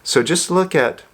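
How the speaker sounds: ordinary delivery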